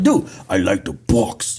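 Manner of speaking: low, slow mock Ukrainian accent; imitated Ukrainian accent. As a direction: do the kid's Ukrainian accent, deep and drawn out. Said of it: "he talked like".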